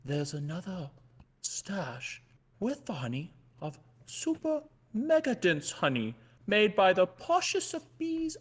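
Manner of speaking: ordinary talk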